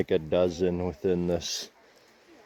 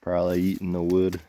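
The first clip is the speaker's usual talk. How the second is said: talking around a dip in the lip. Real, not acted. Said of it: "If I sound weird, it's 'cause I got a dip in my mouth".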